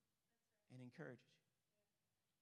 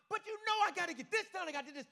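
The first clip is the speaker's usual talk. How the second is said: loud, high, pleading objection; frantic protest; 11 semitones higher, 10 dB louder, faster than usual. Retold or imitated. Stressed man insisting to God he must finish, frantic. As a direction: argue back urgently, voice raised high.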